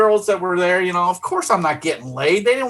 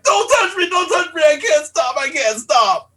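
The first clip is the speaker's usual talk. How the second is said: panicked yell; 12 semitones higher than usual. Retold or imitated. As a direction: shout them off, helpless and urgent. Drunk mid-pee, warding friend off.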